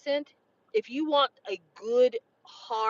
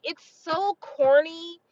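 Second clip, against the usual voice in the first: gushing excitement; 6 semitones higher, 4 dB louder, slower than usual. Real, not acted. Gushing about campy vampire movies.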